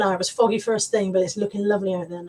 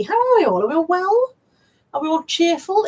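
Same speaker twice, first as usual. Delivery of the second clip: sing-song cheery greeting; 8 semitones higher, 3 dB louder than usual. Pretend. High sing-song greeting to chat.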